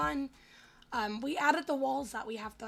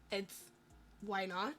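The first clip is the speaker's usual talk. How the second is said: normal talking voice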